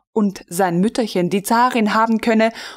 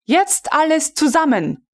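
commanding; 8 semitones higher than usual. A narrator's reading. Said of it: "befahl der weibliche Oberst".

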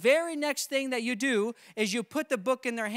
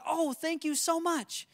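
grateful householder; 6 semitones higher than usual. Retold or imitated. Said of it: "One is gonna be".

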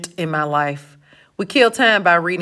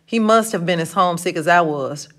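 usual speaking voice

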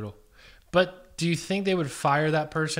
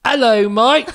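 put-on loud, high, Australian-sounding British accent; attempted British accent; 9 semitones higher, 10 dB louder, slower than usual. Partner trying an accent on request, loud botched British. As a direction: belt out a badly faked British greeting. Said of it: "Do a British accent".

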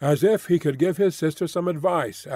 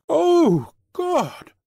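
much higher, louder narrated scream; high-pitched scream; 5 dB louder than usual. A narrator's reading. Mother catches sight of Gregor on the wallpaper, high-pitched scream. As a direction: cry out high and loud in horror. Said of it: "screamed out in a high-pitched, raw voice".

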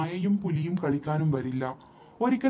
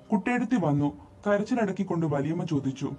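usual speaking voice

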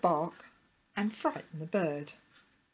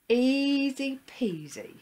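slow, high, stretched vowels; singsong drawn-out boast; confident relish; 8 semitones higher, 5 dB louder, slower than usual. A narrator's reading. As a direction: savour it with smug ease. Willie confident about his plan.

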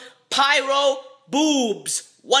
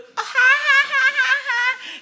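high squealing laugh; high giggle; 13 semitones higher, 3 dB louder than usual. Real, not acted. Laughing at banana hook.

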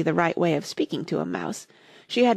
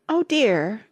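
higher-pitched cry of dismay; sorrowful; 4 semitones higher, 3 dB louder than usual. A narrator's reading. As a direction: lament it in mild sorrow. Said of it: "cried Alice in a sorrowful tone".

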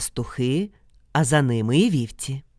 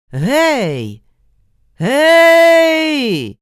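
long drawn-out hollers, second much higher and louder; answering shout; 10 semitones higher, 9 dB louder than usual. Acted. Mykola answering the unseen caller, long holler. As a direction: call back into the dark forest. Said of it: "Микола озвався".

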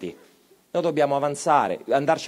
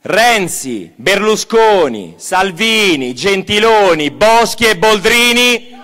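loud name list; 5 semitones higher, 9 dB louder, slower than usual. Shown as a demonstration. Rally speaker calling out names for the crowd to answer, loud. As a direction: shout each name high and loud, slowing on "Berlusconi". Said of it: "Io dirò quattro nomi".

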